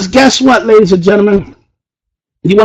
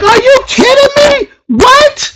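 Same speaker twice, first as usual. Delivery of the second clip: very high, delighted squeal of disbelief; excited disbelief; 13 semitones higher than usual. Retold or imitated. Retelling seeing the downline's back office, squealing. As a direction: shriek it like you can't believe the numbers. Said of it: "I'm like".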